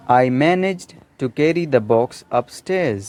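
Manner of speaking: a deliberate dictation pace; slow example sentence. Shown as a demonstration. Teacher reading a model sentence slowly to students. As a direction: read slowly, every word clear. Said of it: "manage यानी कि संभालना".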